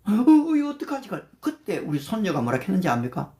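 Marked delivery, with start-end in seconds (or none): 0.0-1.1 alarmed high cry of surprise, then a worried question; mother startled at the wheel, suddenly alarmed. Retold or imitated. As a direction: panic at the wrong turn on the highway. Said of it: "막 놀라더래요".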